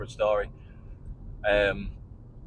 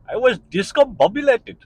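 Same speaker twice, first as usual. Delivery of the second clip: put-on playful Indian accent, pitched up high and loud; 11 semitones higher, 7 dB louder than usual. To his listeners, savouring the word in an Indian accent. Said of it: "I need to be an Indian person to say that".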